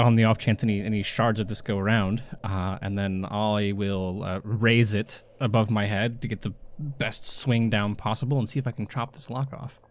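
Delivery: normal talking voice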